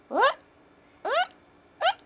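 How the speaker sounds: loud high call. Real, not acted. Owner calling loudly to get the pets' attention.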